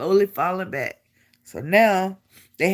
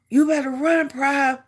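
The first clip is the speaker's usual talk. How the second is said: retold sergeant's order; 7 semitones higher than usual. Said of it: "The more he yells".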